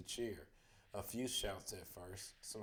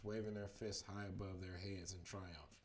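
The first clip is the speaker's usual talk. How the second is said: ordinary delivery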